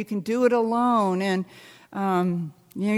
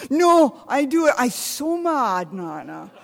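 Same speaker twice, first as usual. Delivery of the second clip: grandson's mad voice; 4 semitones higher than usual. Retold or imitated. Grandma imitating grandson refusing her help. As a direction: insist like a stubborn toddler. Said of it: "He goes".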